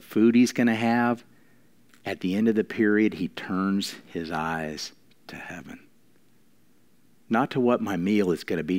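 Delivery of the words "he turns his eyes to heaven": slow, soft whisper, almost no voice; hushed whisper. Acted. Preacher to congregation, whispering in hushed awe. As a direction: breathe it out in awe, slowly. Preacher describes the king's turn to heaven.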